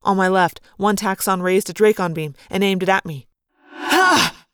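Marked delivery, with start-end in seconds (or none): 3.7-4.5 sudden high triumphant yell; a sharp, high battle cry. Acted. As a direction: shout it out in triumph as you fire. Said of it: "I yelled".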